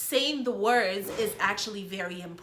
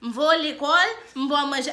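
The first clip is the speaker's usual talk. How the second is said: put-on Oka accent; 4 semitones higher, 7 dB louder than usual. Mom laughing at how her friend's Oka parents talk, in a hammed-up Oka accent. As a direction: put on the Oka accent, stretching each word. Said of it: "I can't even do the accent".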